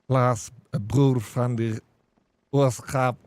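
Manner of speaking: slow, deliberate Afrikaans words; Afrikaans speech. Shown as a demonstration. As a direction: speak it like a farm Afrikaner. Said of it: "Speak like that".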